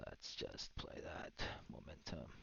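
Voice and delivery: slow, breathy whisper to the viewers. Real, not acted. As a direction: let it out in a hushed whisper.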